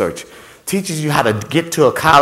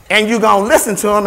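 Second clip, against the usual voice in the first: normal talking voice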